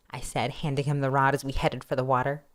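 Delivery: plain reading voice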